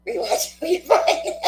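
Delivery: giggling, slow and high through a fumbled word; high, slow giggly mumble; laughing through the words. Real, not acted. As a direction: laugh it out at your own slip. Laughing at her own slip.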